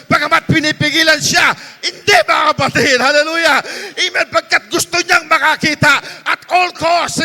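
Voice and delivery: loud, fervent, sing-song preaching; preaching at full force. Acted. Pastor urging the church to keep praying, fervent shouted preaching. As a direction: drive it home to the congregation.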